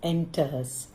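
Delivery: ordinary delivery, nothing marked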